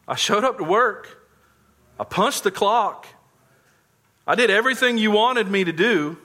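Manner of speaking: the elder son complaining to his father, high and aggrieved; self-pitying complaint. Acted. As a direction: whine about how unfair it all is. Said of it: "murmuring and complaining and wallowing in self-pity".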